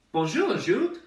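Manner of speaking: high, loud, jolly greeting; imagined French baker. Pretend. Friendly baker greeting his new regular, high and hearty. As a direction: sing out a hearty hello. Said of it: "make friends with a, a baker".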